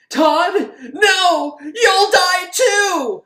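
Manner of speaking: high, very loud, stretched-out scream; frantic scream. Retold or imitated. Heroine screaming after Todd as he runs out, high frantic shriek. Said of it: "she's like".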